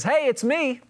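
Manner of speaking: chirpy mock phone hello; cheery caller. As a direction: imitate a caller announcing themselves brightly.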